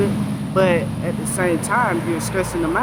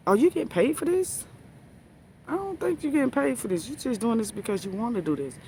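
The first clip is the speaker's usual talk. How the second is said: incredulous sass; 7 semitones higher, faster than usual. Retold or imitated. Sarcastic questions about bus-stop neighbor.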